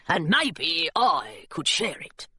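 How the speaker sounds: put-on creature voice, drawn out; gargoyle. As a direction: draw it out low as a hopeful creature. Gargoyle speaks in story.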